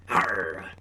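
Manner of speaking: growly pirate arr. Shown as a demonstration. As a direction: belt it like a pirate. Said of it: "really get into the pirate voice".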